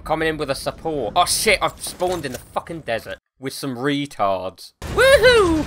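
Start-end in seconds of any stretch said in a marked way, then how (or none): 4.8-5.6 high-pitched gleeful woohoo; drawn-up cheering whoop. Real, not acted. Cheering in play.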